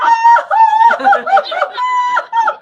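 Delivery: very high, loud shriek of laughter. Real, not acted. Shrieking with laughter at being speechless before the president. Could not answer the president.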